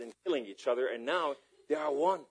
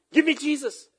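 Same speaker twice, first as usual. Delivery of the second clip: higher-pitched imitation of her demand; pitched-up quote of an eager woman; acted plea; 12 semitones higher, 7 dB louder than usual.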